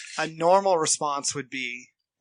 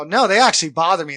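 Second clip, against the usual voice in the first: louder, insisting they bother me; retold protest, said much louder; insistent retort; 3 semitones higher, 9 dB louder, faster than usual. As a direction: push back hard on the listener. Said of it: "And I go".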